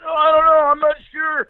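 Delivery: very high mock-innocent dodge; flustered dad. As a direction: play dumb in a high, strained pitch. Dad dodging son's awkward question.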